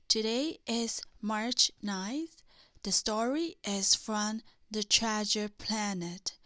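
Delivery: ordinary delivery, nothing marked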